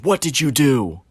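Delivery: high, loud startled demand; retelling the manager snapping at the worker, loud and high; manager's sharp demand. Retold or imitated. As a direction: bark it out like a shocked boss. Manager's sharp question retold.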